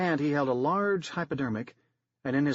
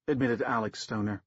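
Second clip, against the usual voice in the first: ordinary delivery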